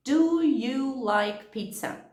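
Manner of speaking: "Do you like pizza?" slow, each word separate; careful full form. Shown as a demonstration. Teacher showing the unlinked form, very slow. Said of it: "Por exemplo".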